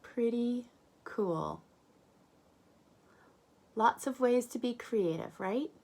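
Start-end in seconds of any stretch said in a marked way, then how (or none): none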